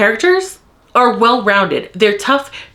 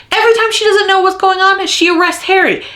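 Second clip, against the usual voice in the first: high, fast, heated rant; real fury; 7 semitones higher than usual. Real, not acted. Book vlogger furious at a character, fast high rant. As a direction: rant it out at full outrage. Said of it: "I'm so mad right now".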